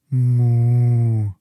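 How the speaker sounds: loud, long drawn moo; a sad yes in cow; agreeing moo. Acted. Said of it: "nickte Carola".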